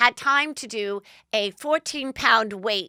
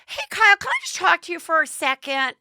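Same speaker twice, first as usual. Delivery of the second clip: high-pitched, louder mock call to a friend; imitated peppy high hail; Lisa Rinna; 10 semitones higher, 5 dB louder than usual. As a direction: put on an eager, high attention-getting voice. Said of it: "Lisa Rinna's like".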